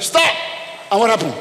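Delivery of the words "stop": high loud command; traffic officer's shout. Retold or imitated. Traffic controller halting cars, high and loud. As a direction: bark the stop order. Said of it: "they do like".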